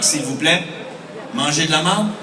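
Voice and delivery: ordinary talk, nothing marked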